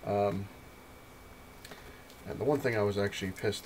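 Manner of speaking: ordinary talk, nothing marked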